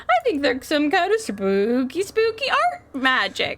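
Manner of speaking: put-on high, eager gee-whiz kid voice; wholesome 1950s kid. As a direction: keep up the wide-eyed boy. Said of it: "Patterson was like".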